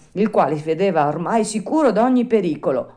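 ordinary delivery, nothing marked

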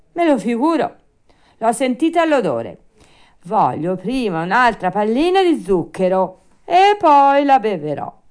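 high, loud, bratty bargaining; Pinocchio, stubborn. Acted. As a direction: insist like a spoiled puppet.

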